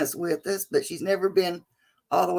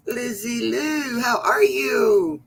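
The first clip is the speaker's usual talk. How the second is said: excited greeting; 4 semitones higher, slower than usual. Real, not acted. Calling greeting to a viewer.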